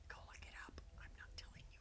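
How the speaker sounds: whispered aside to the audience; mock-secretive whisper. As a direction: drop to a teasing whisper.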